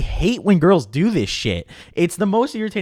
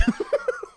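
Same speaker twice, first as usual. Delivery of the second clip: high burst of laughter; 5 dB quieter than usual. Real, not acted. Laughing at own comparison.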